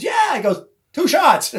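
Kenny voiced. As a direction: punch the line up high, like your buddy. Kenny voiced, punchline of the story.

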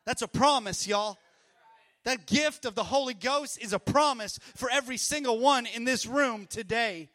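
full-voiced preacher reading scripture; preaching at full force. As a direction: thunder it out to the congregation. Preaching to the congregation.

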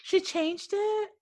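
high, slow, disbelieving question; incredulous. Real, not acted. To friends about Kelly's name change, high slow disbelief. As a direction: stretch it out in pure disbelief.

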